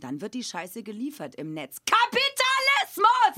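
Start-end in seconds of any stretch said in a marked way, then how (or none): 1.9-3.4 very high, loud and slow drawn-out shout; bellowed slogan. Acted. In the comic trip sketch, he bellows an outraged slogan. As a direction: bellow it like a protest chant.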